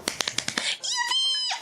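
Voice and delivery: tiny, very high soft squeal; small falsetto hooray; squeaky cheer. Pretend. Cheering a good outcome.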